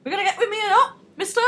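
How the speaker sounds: put-on high, loud demanding girl voice; mock girl at door. Girl turning up at the door, shrill-free high mock demand. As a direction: ham up a pushy girl on the doorstep. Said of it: "she's like".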